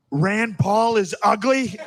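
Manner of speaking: high, slow Trump voice; Trump impression. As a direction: drag it out like Trump. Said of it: "he was just like".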